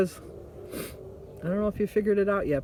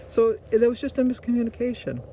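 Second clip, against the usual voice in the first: normal talking voice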